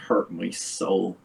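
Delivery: hurt my soul said slowly; mournful slow echo of hurt my soul; slow, drawn-out repeat. Shown as a demonstration. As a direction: drag out each word of hurt my soul for weight.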